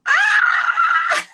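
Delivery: very high, loud squeal; excited scream. Real, not acted. Fan finally meets the author live, screaming with excitement. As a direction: let out a piercing, thrilled shriek.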